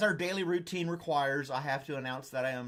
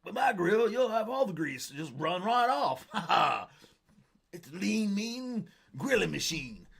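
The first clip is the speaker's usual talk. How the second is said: George Foreman pitch; 6 semitones higher, 4 dB louder than usual. Retold or imitated. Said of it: "Remember him with the George Foreman grill?".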